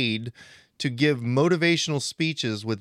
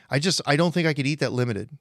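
ordinary talk

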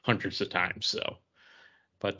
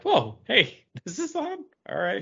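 high, delighted double take at the TV; a pleased little gasp of discovery; mock surprise, pitched up; surprised; 9 semitones higher than usual. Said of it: "and you're like".

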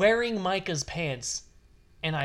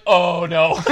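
laughing oh no; 10 dB louder than usual. Retold or imitated. Retold friend's reaction to fart.

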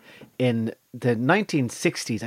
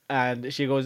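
ordinary talk, nothing marked

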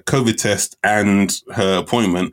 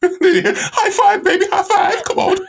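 high, laughing cheer; high-pitched giddy boast to Susie; mock triumphant; slower than usual. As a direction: celebrate like a smug winner. Joking post-sex celebration.

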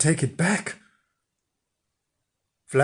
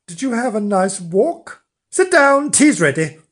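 bossy wife; 7 semitones higher, 6 dB louder than usual. Acted. Wife orders him to sit.

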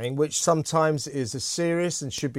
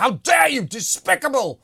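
high, loud mock outrage; retold shout; 7 semitones higher, 3 dB louder than usual. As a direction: mimic a teacher's angry outburst. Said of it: "started shouting".

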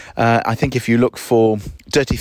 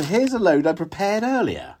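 put-on chirpy, much higher kids' TV voice; Blue Peter presenter; 10 semitones higher than usual. To listeners, sending up Monty Don as a Blue Peter host, chirpy high voice. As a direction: sing it out like a children's show host. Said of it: "It was like Blue Peter".

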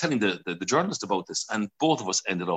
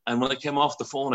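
ordinary talk

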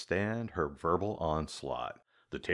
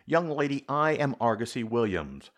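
louder, firm and indignant; a fussy man insisting on his name, louder; emphatic stomp; 6 semitones higher, 6 dB louder than usual. Acted. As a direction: put your foot down with every word. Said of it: "stomped ... for emphasis".

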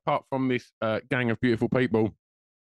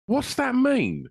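baffled; 14 semitones higher, much slower than usual. Real, not acted. Baffled at the slang.

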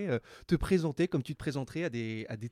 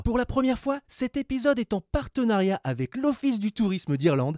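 bright, higher host read, slowing down; podcast host intro; 6 semitones higher, 4 dB louder, slower than usual. Acted. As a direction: welcome listeners like a radio host. Animateur lit l'intro du podcast. (lecture d'intro d'animateur, plus aiguë et posée)

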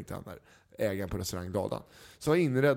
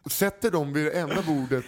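ordinary talk

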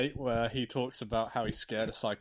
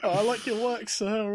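mock fan; 8 semitones higher than usual. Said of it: "You're like".

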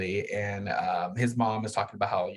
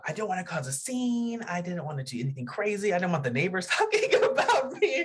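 higher, laughing mock-mom voice; imitating her mom; 7 semitones higher than usual. Imitating her own mom worrying about the neighbors, laughing. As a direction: play the fussy mom, pitched up and amused. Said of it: "she would be like".

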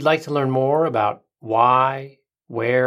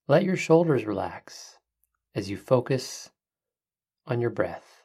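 soft spa whisper; 5 dB quieter than usual. Acted. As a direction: hush it down, calm and unhurried. Leads a guided breathing meditation.

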